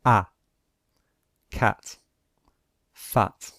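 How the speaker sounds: each sound and word said slowly and clearly on its own; teacher modelling short vowels one by one; model vowel drill. Shown as a demonstration. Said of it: "Now, short vowels".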